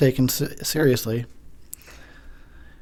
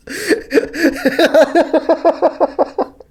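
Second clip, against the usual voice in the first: high, loud laughing fit; can't stop laughing; long laugh; 6 dB louder than usual. Real, not acted.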